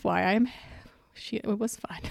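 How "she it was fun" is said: breathy whispered aside. Real, not acted. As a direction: drop to a whisper. Shy aside after being flattered.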